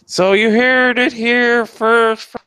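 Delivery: loud, high, slow mock announcer; high, slow, loud sarcastic proclamation; sarcastic announcement. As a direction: proclaim it as fake breaking news.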